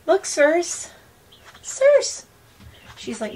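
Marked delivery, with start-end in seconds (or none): 0.1-2.3 very high cooing call; calling the bird. Real, not acted. Trying to get the bird to look, very high call. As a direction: call up high for the bird's attention.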